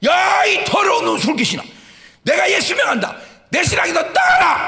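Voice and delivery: put-on wife yelling at her drunk husband's demon; rebuking shout. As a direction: bellow at the drink demon. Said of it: "눈을 버럭 뜨고".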